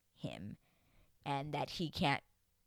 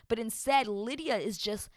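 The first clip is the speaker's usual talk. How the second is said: normal talking voice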